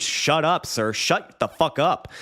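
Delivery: fed up, dragging out the last command; getting mad. Real, not acted. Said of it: "I'm getting so mad".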